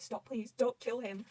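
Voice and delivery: ordinary delivery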